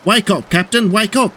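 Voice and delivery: high-pitched cry to rouse someone; urgent cry. A narrator's reading. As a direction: cry out to wake him. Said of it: "cried a worker".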